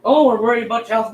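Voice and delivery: loud, sing-song mock of a concerned troll; mocking fake concern. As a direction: sneer it back at them as fake sympathy.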